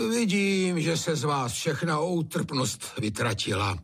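high, wounded sigh; sighing reproach. A narrator's reading. Master finds no pity left, high sighing complaint. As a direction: heave out the reproach mournfully.

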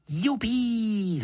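put-on high, jubilant boy's whoop; gleeful high-pitched cry; Jonatán. A narrator's reading. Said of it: "zvolal Jonatán".

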